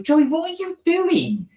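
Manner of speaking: high, slow mock exclamation; incredulous agent. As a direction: cry out in exaggerated disbelief at your client. Agent challenges Joey in retold story.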